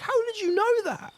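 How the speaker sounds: very high astonished squeak; amazed boatman. Acted. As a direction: squeak the question in disbelief. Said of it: "He's like".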